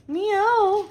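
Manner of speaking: high, loud call to a pet; loud sing-out of a pet's name; calling the cat. Real, not acted. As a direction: call the cat's name out, pitched right up.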